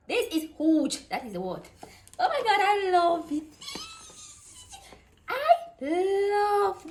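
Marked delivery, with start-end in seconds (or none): 2.2-6.8 loud, high squeal of delight; overjoyed, high-pitched outburst; ecstatic. Real, not acted. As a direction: gush at full volume, stretching the words. Squealing over the revealed gift.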